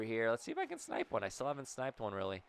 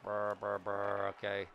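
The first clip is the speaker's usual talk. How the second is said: usual speaking voice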